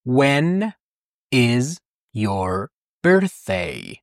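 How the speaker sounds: drawn-out careful English; teacher modelling very slowly; very slow question. Shown as a demonstration. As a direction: stretch each word out. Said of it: "When یعنی کی؟".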